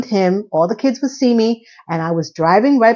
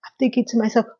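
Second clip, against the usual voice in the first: ordinary delivery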